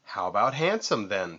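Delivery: ordinary delivery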